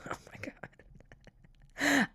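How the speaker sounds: breathy whispered oh my God, very slow; whispered disbelief. Real, not acted. Laughing in disbelief at the salesman's request, whispered.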